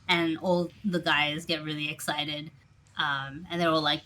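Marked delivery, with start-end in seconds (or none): none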